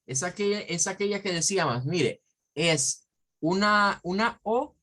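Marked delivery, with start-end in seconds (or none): none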